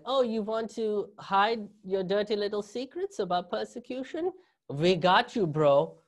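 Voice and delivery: mock-innocent Germans playing dumb in a German accent. Comedian mocking German authorities in a German accent. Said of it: "the best German accent you guys are gonna get out of me".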